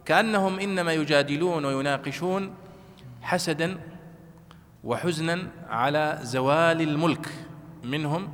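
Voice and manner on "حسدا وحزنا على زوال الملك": ordinary talk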